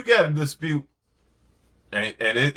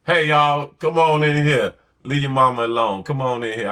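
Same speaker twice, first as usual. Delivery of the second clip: put-on louder call across the house to the kids; 5 dB louder than usual. Husband calling the kids away from their tired mother, louder. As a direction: call the kids in to give mom a break.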